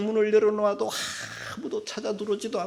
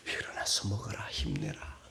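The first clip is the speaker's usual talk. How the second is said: soft, low, hushed encouragement; tender whisper; 11 semitones lower, 11 dB quieter than usual. Acted. God gently telling exhausted Elijah to eat, tender whisper. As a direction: whisper it like God soothing a worn-out prophet.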